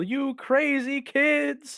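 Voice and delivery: drawn-out high yell out the window; long, high scolding shout. Acted. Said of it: "I open the window and I yell".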